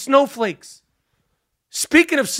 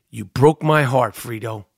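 betrayed brother, lower and hushed; Michael Corleone quote; 10 semitones lower than usual. Retold or imitated. Comic quoting Corleone to Bernie, lower and wounded. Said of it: "the great Michael Corleone".

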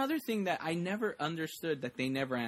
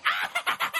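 very high mock scream; imitated scream; 4 dB louder than usual. Retelling someone's cry as the paper plane flies by, high mock scream. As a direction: let out a sudden high yelp. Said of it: "you hear someone go".

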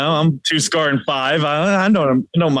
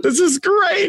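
much higher, delighted shout; excited cheer. Real, not acted. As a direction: cheer it out gleefully. Cheering on someone calling out Matt.

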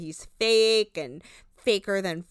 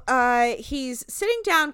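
ordinary talk, nothing marked

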